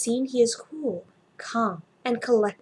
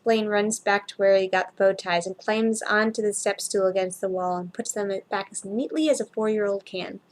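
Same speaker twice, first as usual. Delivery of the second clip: plain reading voice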